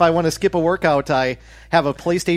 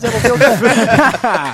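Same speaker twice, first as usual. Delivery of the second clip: drawn out through a laugh; 7 semitones higher, 8 dB louder, much slower than usual. Real, not acted. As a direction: savour it, laughing slowly. Gloating over his own win.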